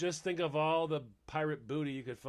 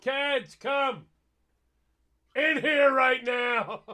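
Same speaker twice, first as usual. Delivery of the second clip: higher, loud, slow call across the house; calling kids to dinner; 8 semitones higher, 7 dB louder, much slower than usual. Pretend. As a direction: shout for the kids to come in for dinner. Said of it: "I can just hear you calling the kids in for dinner".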